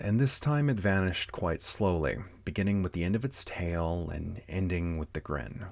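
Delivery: ordinary delivery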